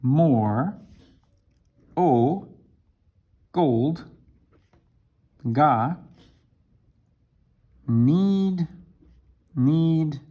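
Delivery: hesitant learner with high stretched words; slow halting Japanese-order English. Shown as a demonstration. Teacher mimics a learner translating from Japanese, halting. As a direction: piece it together like a lost learner. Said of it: "you would say".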